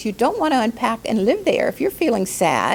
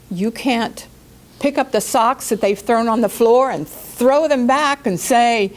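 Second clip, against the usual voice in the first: ordinary talk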